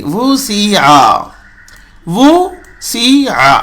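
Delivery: slow وہ سی آ with heavy sad; slow syllable drill. Shown as a demonstration. Teacher spells the word slowly for students. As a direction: draw out وہ سی آ. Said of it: "سین کو تو صاد نہیں پڑھیں گے".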